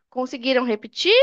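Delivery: ordinary delivery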